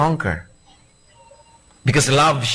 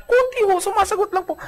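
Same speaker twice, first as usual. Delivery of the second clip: gentle meek reply. Acted. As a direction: answer sweetly and small. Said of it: "Ganyan kabanayad". (mahinhing banayad na sagot)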